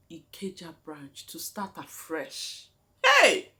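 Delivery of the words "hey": sudden yelp, much higher and louder; a sharp cry of surprise; startled shout. Real, not acted. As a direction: blurt it out in shock. Startled outburst mid story.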